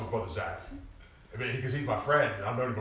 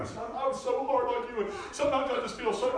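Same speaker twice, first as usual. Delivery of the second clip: mock tearful mom. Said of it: "she's crying".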